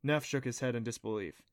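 plain reading voice

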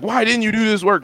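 mock a parent's yell, pitch pushed up; imitating yelling mom. Mom yelling at child over missing work, mock yelling. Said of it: "my mom was yelling at me".

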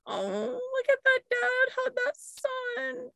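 high, slow teary gush; mock weepy gush. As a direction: gush like you're about to cry. Imitating crying at random things.

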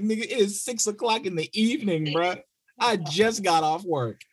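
high mock mother fed up after work; exasperated mom. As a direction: scold like a tired mom. Tired mom scolding, voiced.

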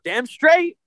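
usual speaking voice

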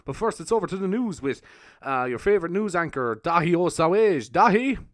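loud DJ handing over to the news; radio voice. Pretend. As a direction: announce like a radio host. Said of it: "I'd do the old radio voice anyway".

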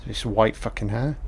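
ordinary talk, nothing marked

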